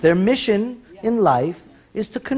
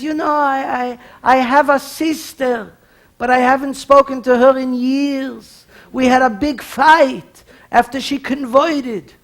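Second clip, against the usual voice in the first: patient's complaint; 7 semitones higher, 3 dB louder, slower than usual. Acted. Patient tells nun about his sister.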